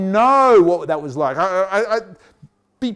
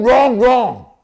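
louder stressed repetition of wrong; insistent repetition; 3 semitones higher, 5 dB louder than usual. Real, not acted. Preacher insisting to the congregation, louder. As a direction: hammer the word home.

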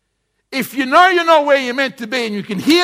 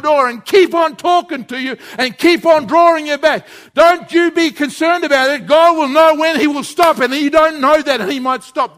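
louder, higher, pressing the warning home; preaching at full force; 4 semitones higher, 4 dB louder than usual. Acted. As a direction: preach it hard to the congregation. Sermon climax, raised voice.

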